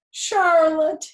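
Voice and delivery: loud, high, grief-stricken wail of her name; moaning. A narrator's reading. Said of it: "he moaned".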